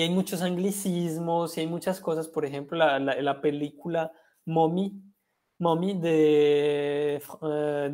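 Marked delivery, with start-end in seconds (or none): none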